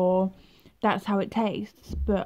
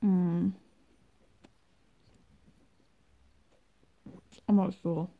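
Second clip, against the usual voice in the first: chewing while talking; muffled by chalky paste; mouth full; 3 dB quieter than usual. Real, not acted. Seen 0: Mouth full, chewing throughout; cornstarch box held with straw.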